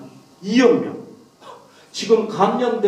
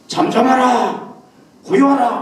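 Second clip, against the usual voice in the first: shouted rebuke; 7 semitones higher, 6 dB louder than usual. Acted. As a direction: shout down the storm as Jesus. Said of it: "이 풍랑을 향해서 외친 거예요".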